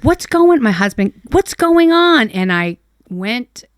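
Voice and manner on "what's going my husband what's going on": husband calling out. Retold or imitated. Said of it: "and Guy goes".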